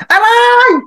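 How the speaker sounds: high, loud pained cry of ouch; sudden yelp. Retold or imitated. LRT passenger burned by hot rice, high yelp. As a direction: yelp as if scalded. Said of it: "may sumisigaw".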